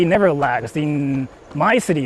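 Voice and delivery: ordinary talk, nothing marked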